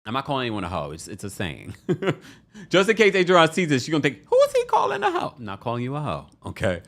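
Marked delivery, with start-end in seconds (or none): 4.3-5.3 high-pitched mimicked outrage; mock indignation. Host imagines Adriana misreading his remark, mock indignation. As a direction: squeal it as an offended woman.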